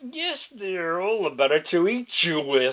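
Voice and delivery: loud, mock-sweet wolf; menacing wolf reveal. A narrator's reading. Wolf about to eat the girl, loud menacing reveal. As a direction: purr then pounce.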